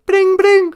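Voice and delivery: high, loud sung ringtone; mock telephone ring, high and loud; phone ring imitation. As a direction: chirp it out like a ringing phone. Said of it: "is that the phone?".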